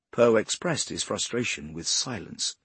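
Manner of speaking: plain reading voice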